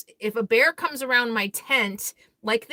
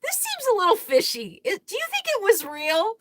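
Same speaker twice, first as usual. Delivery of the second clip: high incredulous voice; 10 semitones higher than usual. Retold or imitated. Asking her friend if the film was real, in a high doubtful voice. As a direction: lift it way up, doubtful and suspicious. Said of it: "I was like".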